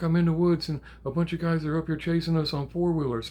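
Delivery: high, loud, breathless-rush imitation; urgent report. Granddaughter tells grandpa she's being chased, urgent report. Granddaughter explains the danger by phone.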